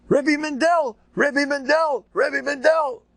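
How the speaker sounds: put-on high, urgent child's call, repeated; a small boy shouting across the yard; kids calling out. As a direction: call for the teacher like a frantic kid. Kids calling teacher at recess.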